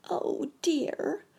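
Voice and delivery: high frightened cry; very much afraid. A narrator's reading. Sammy caught by the pessimist, high and afraid. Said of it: "cried Sammy, very much afraid".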